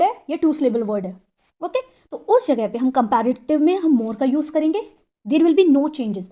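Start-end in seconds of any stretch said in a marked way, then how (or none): none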